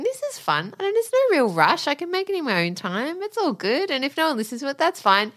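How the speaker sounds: sing-song, everything is fine; carefree inner voice. Retold or imitated. Imitating her carefree inner thoughts.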